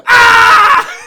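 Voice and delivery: sudden high, very loud scream; imitated scream. Teammate distracting a shooter at the line, imitated full scream. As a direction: mimic screaming at a free-throw shooter. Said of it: "He would just fucking scream".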